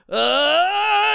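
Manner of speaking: high, loud stretched groan; hammed-up groan peaking high and loud; mock climax groan. Man acting out the finish, high loud groan.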